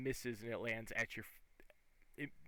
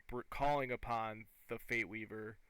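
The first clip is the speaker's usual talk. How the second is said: ordinary talk, nothing marked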